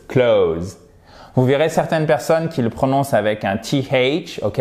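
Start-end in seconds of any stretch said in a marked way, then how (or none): 0.1-0.8 "Clothes" as one syllable ending in Z, no TH; simplified pronunciation. Shown as a demonstration. Teacher repeating the easy form for learners, "Clothes" without TH. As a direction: skip the TH in "Clothes", like "close" with Z. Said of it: "ça nous facilite les choses".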